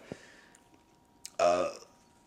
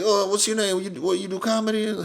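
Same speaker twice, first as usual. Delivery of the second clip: [name] voice; 10 semitones higher than usual. Retold or imitated. Imitating [name] asking the comic about himself, high. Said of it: "He talks like that?".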